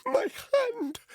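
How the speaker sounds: put-on high, loud pained cry with a Russian accent. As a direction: cry out as the wound hits. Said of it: "He grimaced horribly".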